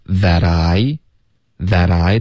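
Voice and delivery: slow linking demo of "that I"; linked, voiced t. Shown as a demonstration. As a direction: blend "that I" into tha-dai. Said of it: "特的音也会浊化".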